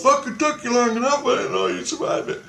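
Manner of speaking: high, thin, half-voiced mock weeping; imitated tearful relief, pitched high; retold crying. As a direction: wail it lightly as if in tears. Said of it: "he's fucking crying, he's crying".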